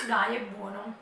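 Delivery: usual speaking voice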